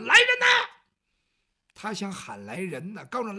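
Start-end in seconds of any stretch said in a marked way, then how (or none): 0.1-0.5 high, loud yell for help; calling for guards. Acted. Eunuch screaming for guards, high loud call. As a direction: shout for the guards at full voice. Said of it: "郭老五就扯嗓子喊".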